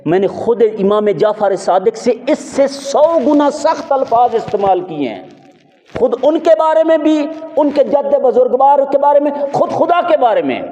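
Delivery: high, insistent preacher's quote; impassioned retold boast. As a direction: build the opponent's boast in high oratory. Acts boastful opponent in preaching.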